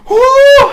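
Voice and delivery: high, loud owl hoot; big storybook "whoo". A narrator's reading. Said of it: "Owl hooted".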